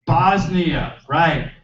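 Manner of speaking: usual speaking voice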